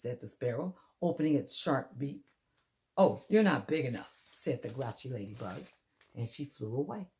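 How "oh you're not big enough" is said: loud brush-off. Acted. Grouchy ladybug dismisses rival.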